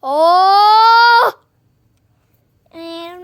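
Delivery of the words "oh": scream. Acted. Doll screams in play.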